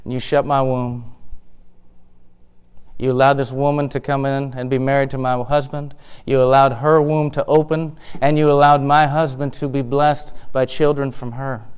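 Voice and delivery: low, soft, heavy lament; subdued complaint to God. Acted. Hannah telling God of her shut womb, subdued lament. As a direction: bring it down to a hushed, hurt prayer. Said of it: "And she says".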